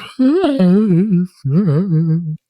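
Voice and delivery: long high, loud dog wail; drawn-out high wailing noise; weird dog whine. Retold or imitated. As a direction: imitate a deaf dog's odd howling whine. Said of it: "really weird noises".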